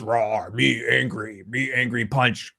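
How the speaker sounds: slow, soft caveman grunt voice; dumb brute Link. Retold or imitated. Mocking Link as a slow dumb caveman. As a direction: play him as a dim brute, slow. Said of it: "And then Link goes".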